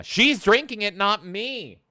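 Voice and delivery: high, loud squawk of self-defence; indignant protest. Real, not acted. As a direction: shoot way up in pitch to deflect blame. Mock protest at viewer's scolding.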